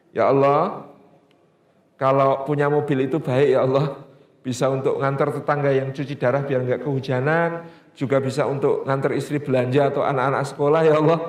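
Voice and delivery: humble prayer. Retold or imitated. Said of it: "suaminya berdoa lagi".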